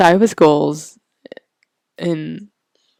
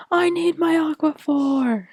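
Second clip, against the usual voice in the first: mock screaming; 10 semitones higher than usual. Retelling being without Aquaphor, in a mock scream. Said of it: "She was literally screaming".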